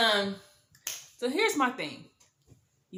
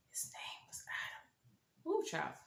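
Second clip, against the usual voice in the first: slow whispered aside; stunned whisper; 3 semitones lower, 11 dB quieter than usual. Real, not acted. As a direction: whisper it as scandalous gossip. Stunned aside on his real name.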